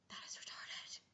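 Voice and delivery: unvoiced muttered whisper. Annoyed friend whispering complaint. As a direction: hiss it under your breath.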